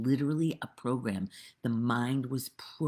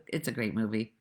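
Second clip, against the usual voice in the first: ordinary delivery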